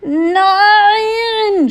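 long, loud, high wail; groaned cry. A narrator's reading. Leo watches the prisoner escape, groaning loudly. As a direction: groan it out as one drawn-out cry. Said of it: "stöhnte Leo der Lügner".

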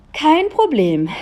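loud cheerful no-problem; confident exclamation. A narrator's reading. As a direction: toss it off boldly. Julia confidently answers parents.

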